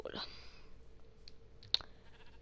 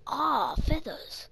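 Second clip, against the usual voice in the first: drawn-out, louder delighted "Ah, feathers"; pleased discovery; 5 semitones higher, 8 dB louder, much slower than usual. Real, not acted. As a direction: stretch it out, glad to find them. Finds feathers while playing.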